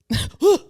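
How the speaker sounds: sharp high gasp; sudden mock-startled intake. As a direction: imitate jolting awake with a gasp. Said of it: "pops up".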